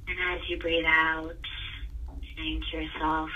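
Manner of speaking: guided meditation. Acted. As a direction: guide them gently into calm breathing. Leads a breathing exercise.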